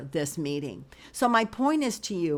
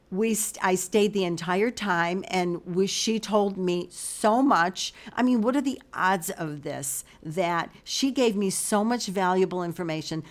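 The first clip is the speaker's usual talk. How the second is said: usual speaking voice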